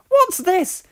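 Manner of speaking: very high, loud screech. Acted. Trucks shrieking at Donald, very high screech. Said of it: "they screeched".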